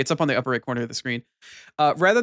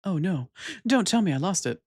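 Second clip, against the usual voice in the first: put-on absent-minded girl; 5 semitones higher than usual. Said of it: "Absent-minded girly here".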